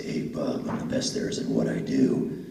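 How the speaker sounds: low gravelly growl, breathy; Wolverine voice. Retold or imitated. Voice actor recalling his Wolverine line in a low growl. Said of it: "my voice wasn't deep enough to say".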